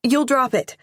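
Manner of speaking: urgent worried plea; anxious plea. Acted. Girl anxious over the box.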